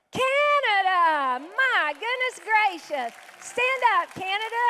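high, loud and drawn-out amazement; delighted amazement. Real, not acted. Pastor greeting visitors from far away, amazed. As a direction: exclaim slowly in happy surprise, then call them up.

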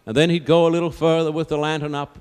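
ordinary delivery

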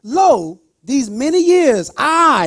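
loud, high complaining son; resentful older son. Retold or imitated. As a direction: throw it at the father like a grudge. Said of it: "So he answered and said to his father".

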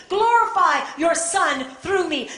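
slow, loud, high plea to God; fervent prayer. Acted. Preacher calling on God to glorify the Son, fervent prayer. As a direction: cry it out as an impassioned pulpit prayer.

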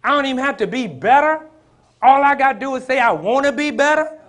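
shouted high preaching, rushing at first; full-force preaching. Acted. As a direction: build to a shouted peak.

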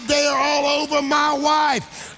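full-force preaching. Real, not acted. Preacher urging the congregation to ooze, full-force preaching. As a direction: rouse the room to make noise.

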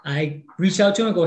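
ordinary talk, nothing marked

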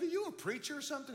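high, quick, incredulous question. Retold or imitated. Student questioning the classmate who quizzes him, incredulous. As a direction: fire it back in disbelief. Retells his startled question to Denny.

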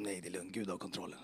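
softly whispered, unhurried reassurance; a soft whisper to calm a worried lawyer. Retold or imitated. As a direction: hush him gently, God is in control.

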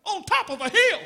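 high, loud rhetorical preaching; mock fervent preacher; brother preaching. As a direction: pose it like a pulpit challenge. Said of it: "He started preaching about".

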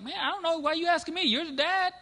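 put-on a father asking the pastor where his own kid is, met with baffled disbelief; incredulous retort. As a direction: toss it back at him, baffled.

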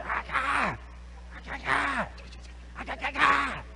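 high, breathless panicked yelps; fleeing crowd in a hammed-up panic; mock panicked cries. Israelites panicking in movie.

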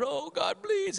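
high pleading "please"; a hint of desperate pleading; mock begging. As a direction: lightly mimic a begging saint. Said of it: "begging God".

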